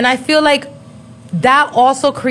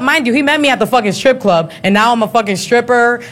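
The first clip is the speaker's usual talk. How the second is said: usual speaking voice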